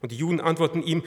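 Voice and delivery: normal talking voice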